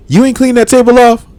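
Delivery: high, loud accusing question; mock aggressive nagging. Imitating partner before guests, aggressive high nagging. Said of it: "I get aggressive for some reason now".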